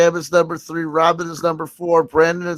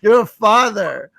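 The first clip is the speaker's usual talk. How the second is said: usual speaking voice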